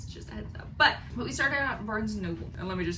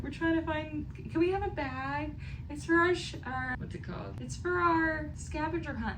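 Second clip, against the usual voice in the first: high, sugary asking voice, pitched well up; playing the overly polite customer; mock sweet request; 8 semitones higher than usual. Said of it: "We were like".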